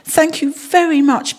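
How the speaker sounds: high, delighted thanks; exclaimed. A narrator's reading. Judy thanking Paddington for paints, exclaiming with delight. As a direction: pitch it up in grateful excitement. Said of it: "exclaimed Judy".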